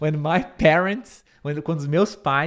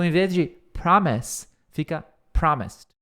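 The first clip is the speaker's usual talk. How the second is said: normal talking voice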